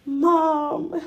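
high, cracking cry of mom; a retold sob, calling out to her mother; sobbing call for mom. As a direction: wail it out like she just lost her. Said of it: "I was sobbing".

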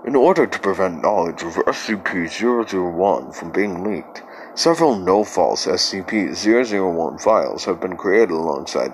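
ominous computer announcement voice; cold, eerie system warning; spooky ride narrator. Acted. Ominous classified file intro.

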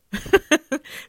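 a loud high burst of laughter. Real, not acted. Laughing off her own harsh self-talk, loud. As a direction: laugh hard at yourself.

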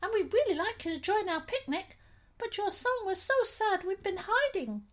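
high, tiny teddy bear. Acted. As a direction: squeak it out like the smallest bear. Teeniest teddy bear speaking.

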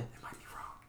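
quick whispered aside. Real, not acted. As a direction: whisper it to yourself. Aside about Spanish.

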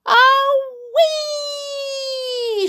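very high, long squeal; gleeful scream. A narrator's reading. Grandma shoots up again, gleeful scream. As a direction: squeal like someone shooting upwards. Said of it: "she screamed".

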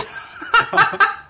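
high laugh. Real, not acted. Laughing at own story.

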